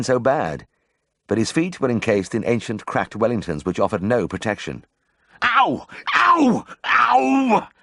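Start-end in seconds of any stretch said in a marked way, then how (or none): 5.4-7.7 very high, loud cries of pain; pained yells. Acted. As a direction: yell as the hoof crushes your toe. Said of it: "yelled Mister Daggett".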